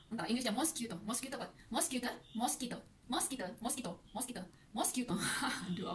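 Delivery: soft rapid mutter, trying the word; muttering to herself. Real, not acted. Teacher muttering to herself over how to say mosquito. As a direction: mumble quickly trying to get mosquito right.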